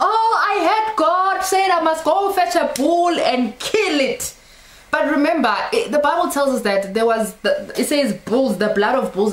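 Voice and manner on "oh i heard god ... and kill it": high, hammed-up imitation of a believer; mocked gullible believer. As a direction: breathlessly report it in mock awe, high-pitched. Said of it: "And you're gonna say".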